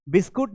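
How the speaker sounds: "बिस्कुट" with u sounded as in put; mispronunciation. Shown as a demonstration. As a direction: demonstrate the wrong reading "बिस्कुट", ending in -kut. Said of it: "नहीं पढ़ना है". ("बिस्कुट" में उ बोलकर ग़लत उच्चारण)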